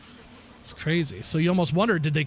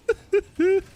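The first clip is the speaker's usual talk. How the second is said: high burst of laughter; 13 semitones higher, 4 dB louder than usual. Real, not acted. Laughs at Bill Cosby joke.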